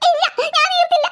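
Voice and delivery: high, laughing defiance; defiant refusal. Acted. A daughter defies her mother, laughing and high. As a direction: refuse mom with a cheeky laugh.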